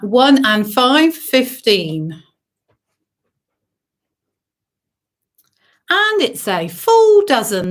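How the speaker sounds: sing-song bingo number calling; bingo caller. Acted. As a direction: call each number out to the hall.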